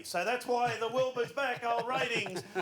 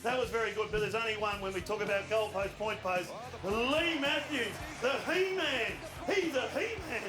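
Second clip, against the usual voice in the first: normal talking voice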